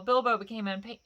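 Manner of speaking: plain reading voice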